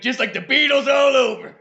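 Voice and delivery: high, loud indignant cry; mock outrage. Patriot bested by British bands again, mock outrage.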